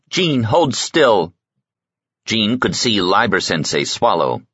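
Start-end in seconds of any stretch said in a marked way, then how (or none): 0.1-1.3 slow, higher, tight with fear; mother's slow frightened command; sharp with dread. A narrator's reading. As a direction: warn her son without daring to move. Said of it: "in a voice sharp with dread".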